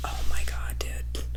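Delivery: hushed, voiceless aghast aside; appalled whisper. Retold or imitated. Reacting to nanny cams in a new relationship, appalled whisper. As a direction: whisper it in retold disbelief.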